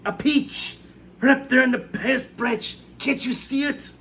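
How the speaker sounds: Aunt Spiker urging her sister to look; excited aunt. A narrator's reading. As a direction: insist she look up. Aunt Spiker shouting, high.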